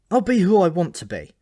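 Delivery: loud, high defiance; defiant. Acted. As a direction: stand up to him loudly. Malcolm defies Alberic.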